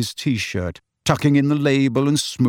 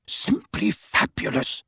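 slow, awed whisper; 9 semitones higher, 5 dB quieter, slower than usual. A narrator's reading. Mister Weasley marvels at ticket machines, whispering. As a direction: breathe it out in hushed delight. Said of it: "he whispered".

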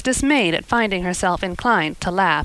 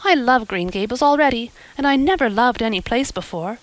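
put-on higher, breathless girlish dreamer; a girl thanking her new benefactor, eager; eager young Anne; 4 semitones higher than usual. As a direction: gush with hope like an imaginative girl. Anne voiced in story.

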